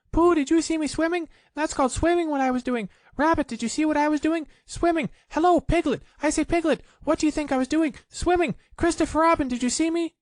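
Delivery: very high, fast, loud squeaky chatter; Roo boasting. A narrator's reading. Roo telling everyone about his swimming, high and fast. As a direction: rattle it off like an overexcited joey.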